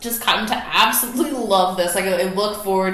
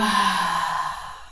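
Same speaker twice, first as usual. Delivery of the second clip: demo exhale sigh; 2 semitones lower than usual. Shown as a demonstration. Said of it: "slowly start to let it go".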